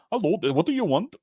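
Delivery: imitating a thick Indian accent. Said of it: "Indian accent".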